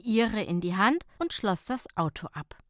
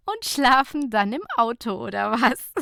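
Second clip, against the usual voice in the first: laughing; 4 semitones higher, 6 dB louder than usual. Acted. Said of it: "lachte Nicola".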